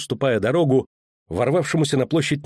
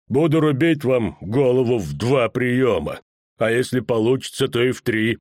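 irritated executioner giving orders; menacing executioner; 3 semitones higher than usual. Acted. Executioner to the stubborn poet at the block, menacing. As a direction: threaten the condemned man in a heavy executioner's voice.

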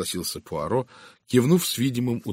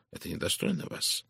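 serious; 8 dB quieter than usual. A narrator's reading. Poirot reproves Miss Howard, serious. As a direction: reprove quietly and gravely. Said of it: "серьёзно произнёс Пуаро".

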